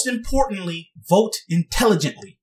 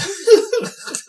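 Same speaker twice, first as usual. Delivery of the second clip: real high laugh; cracking up mid-list; high giggle; 13 semitones higher than usual. Real, not acted. Vlogger laughing at old creepy candidates, high giggle.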